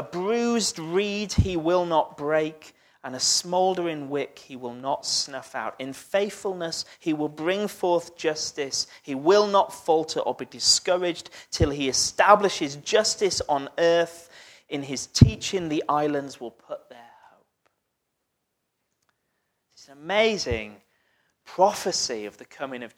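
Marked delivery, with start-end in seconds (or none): 5.8-17.5 louder, measured reading of the scripture. Acted. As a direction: proclaim the prophecy to the room. Reads Isaiah aloud to congregation.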